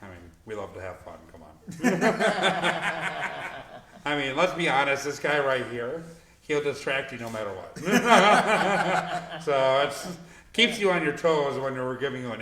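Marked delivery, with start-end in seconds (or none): 1.6-4.0 big burst of laughter; loud laugh. Real, not acted. Host laughs at joke. 7.8-9.4 high, hearty burst of laughter; loud laugh. Real, not acted. Host laughs at joke.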